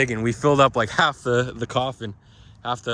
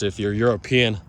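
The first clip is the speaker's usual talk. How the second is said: normal talking voice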